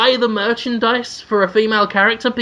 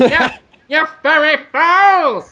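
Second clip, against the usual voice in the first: raised-pitch cartoon villain insult; screeching skull-faced villain; 7 semitones higher, 4 dB louder, slower than usual. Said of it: "skull-faced man who's constantly going".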